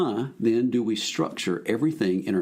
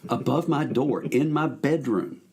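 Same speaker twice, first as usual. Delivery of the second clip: normal talking voice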